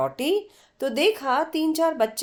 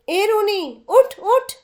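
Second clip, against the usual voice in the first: high, loud rousing call; loud wake-up call; 9 semitones higher, 6 dB louder, slower than usual. Acted. Chitra calling her sleeping friend awake, loud and high. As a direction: shake her awake with your voice.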